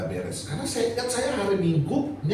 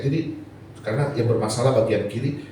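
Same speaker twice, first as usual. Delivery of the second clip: usual speaking voice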